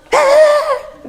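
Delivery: sudden high yelp, laughing through it; mock preacher shout. Teacher mocking a televangelist's shout, high laughing yell. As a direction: imitate the preacher's wild cry, half laughing. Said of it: "dava uns gritos assim".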